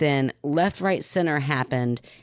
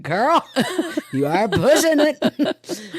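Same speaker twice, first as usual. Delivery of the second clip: high, loud, drawn-out playful reproach; laughing mock scolding; 11 semitones higher, 5 dB louder, slower than usual. Mom warning her not to push it, laughing mock scolding. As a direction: scold teasingly through laughter.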